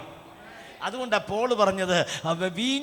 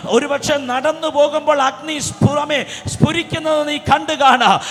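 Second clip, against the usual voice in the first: rising, louder preaching that ends in a shout; building prophetic preaching; 5 semitones higher, 8 dB louder than usual. Acted. Prophesying over the congregation.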